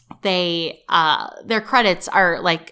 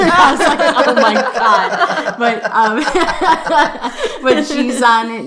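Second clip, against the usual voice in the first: high, loud laughter through the words; laughing; 6 semitones higher, 6 dB louder, slower than usual. Real, not acted. Telling her co-host the story while laughing, high and loud.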